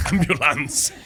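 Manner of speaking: put-on slow, laughing German-accented Ambulance. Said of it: "Can't just say it in a German accent".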